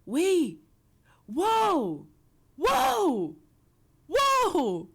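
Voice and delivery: loud, high, drawn-out whoas; awed whoa. Real, not acted. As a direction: gape in loud amazement. Staring at model in awe.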